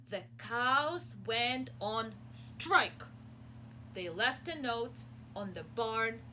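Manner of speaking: slow, loud dramatic narration; storytelling. Acted. Teacher reading the cows' strike, dramatic slow. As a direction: announce the strike slowly.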